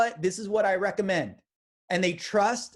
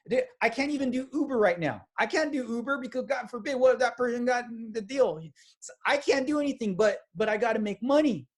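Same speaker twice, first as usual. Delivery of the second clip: panicking friend; 7 semitones higher than usual. Acted. Friend panicking about needing money.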